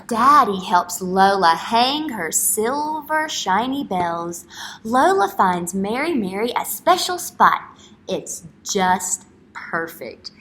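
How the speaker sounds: slow, loud storybook read; reading to children. Acted. Teacher reading a picture book aloud to young children, storybook voice. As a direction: read it out big for the class.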